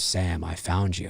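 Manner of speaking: low, slow, soft killer voice; Billy's voice. Retold or imitated. Billy on the phone to Sam, low and slow. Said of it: "when he says".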